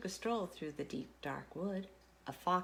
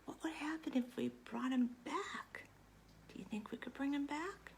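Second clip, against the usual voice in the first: put-on hushed secret whisper to the kids; 4 semitones higher, 6 dB quieter than usual. Whispering to children about bringing the monster back. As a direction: lean in and whisper the question.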